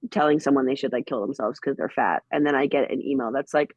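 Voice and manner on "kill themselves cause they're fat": ordinary talk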